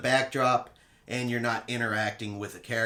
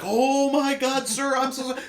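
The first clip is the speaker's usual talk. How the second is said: mortified apology; 13 semitones higher, 5 dB louder than usual. Retold or imitated. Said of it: "looked like he was scared to death".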